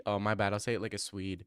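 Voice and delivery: ordinary talk, nothing marked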